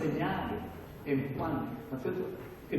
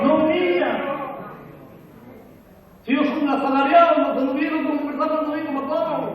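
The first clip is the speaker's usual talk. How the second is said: much higher, loud fervent cry; preaching at full force; 10 semitones higher, 10 dB louder than usual. Acted. Pastor crying out to his flock, loud and high. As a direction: shout it out at the climax.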